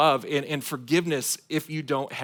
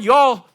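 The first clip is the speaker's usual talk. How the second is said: "y'all" higher and much louder; stressed y'all; 8 semitones higher, 11 dB louder than usual. Shown as a demonstration. Pastor praising Southern speech, loud "y'all". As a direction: pop the word "y'all" high and loud. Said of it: "But the word".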